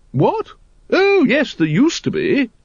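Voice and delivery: high, surprised upper-class exclamation; startled lady. A narrator's reading. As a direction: jump a little at the question.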